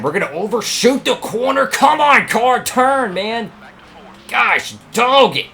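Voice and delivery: high, loud pleading with the car; frantic yelling. Real, not acted. Racer yelling at his car to turn in the corner.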